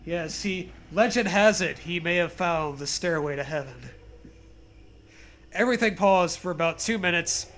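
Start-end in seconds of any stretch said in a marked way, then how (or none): none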